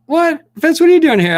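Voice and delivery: very high, loud imitation of astonishment; mock surprised shriek. Imitating Cara catching him in another chat, shrill surprise. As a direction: squeal it like a startled fan.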